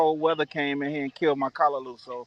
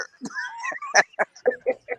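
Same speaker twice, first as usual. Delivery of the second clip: high, squeaky cackling laugh; high wheezing laugh. Real, not acted. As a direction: laugh hard and high at your own bit. Laughing after the imitation.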